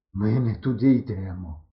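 slow, gasping words; nearly breathless. A narrator's reading. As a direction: speak slowly, almost out of breath. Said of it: "майже задихаючись". (задихано, повільно)

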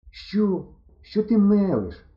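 high, slow incredulous question; disbelieving. A narrator's reading. Не вірить, що заблукали. (недовірливо, високим голосом)

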